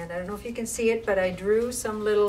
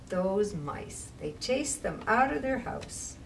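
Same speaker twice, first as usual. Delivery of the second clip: usual speaking voice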